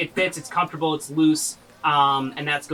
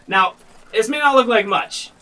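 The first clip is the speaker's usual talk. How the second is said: salesman's pitch; 6 semitones higher, 6 dB louder than usual. Acted. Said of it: "he says".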